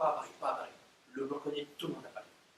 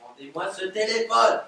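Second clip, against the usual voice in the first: loud, firm order; loud insistence; firm demand; 11 dB louder than usual. Acted. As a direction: demand the phone back. Insisting on the phone.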